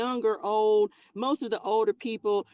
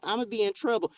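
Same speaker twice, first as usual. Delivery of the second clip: usual speaking voice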